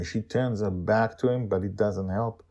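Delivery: ordinary delivery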